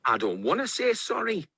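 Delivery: high, defiant storybook yell; mock yell. A narrator's reading. Tomato defies Big Sweetcorn, mock yelling. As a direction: yell the refusal high without extra volume. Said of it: "yelled Tomato".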